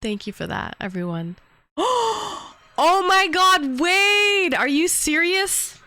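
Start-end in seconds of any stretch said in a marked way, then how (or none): 1.8-2.5 sharp high gasp; surprised gasp. Real, not acted. Surprise at hype train. 2.8-5.8 loud, high disbelief; excited. Real, not acted. Excited reaction to gift.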